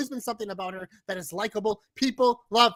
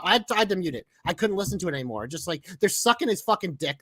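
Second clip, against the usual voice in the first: ordinary delivery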